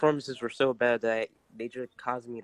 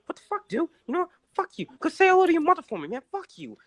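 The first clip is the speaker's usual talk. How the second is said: much higher, fast indignant rant; mock angry Wahlberg; faster than usual. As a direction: rant like an insulted star. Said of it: "Mark Wahlberg in Milo twenty-two".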